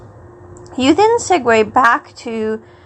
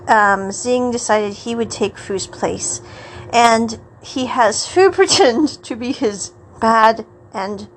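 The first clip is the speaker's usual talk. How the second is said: ordinary talk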